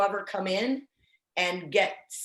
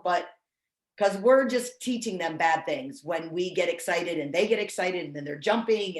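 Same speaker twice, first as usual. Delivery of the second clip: ordinary delivery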